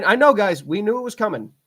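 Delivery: ordinary talk, nothing marked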